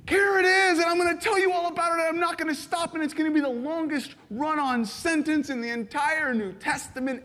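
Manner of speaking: high, breathless, nonstop gush; hammed-up eager apostle, pitched high; excited run-on outburst. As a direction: burst in like a thrilled kid with news. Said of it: "running up to the Ephesian church in this letter".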